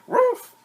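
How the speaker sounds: high yappy bark; playful storybook woof. A narrator's reading. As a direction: bark like a small dog. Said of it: "The dog barked".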